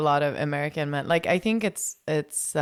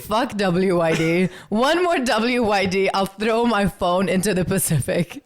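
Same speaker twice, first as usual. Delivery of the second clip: mock outrage; 4 semitones higher, 8 dB louder than usual. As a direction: pretend to be fed up and shout it.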